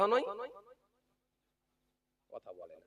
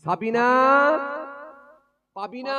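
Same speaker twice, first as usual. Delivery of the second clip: mock long-distance call, higher and louder; 8 semitones higher, 8 dB louder than usual. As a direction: call the girls' names into the dark. Father calling out for daughters. (মেয়েদের নাম ধরে ডাকা, উঁচু গলায়)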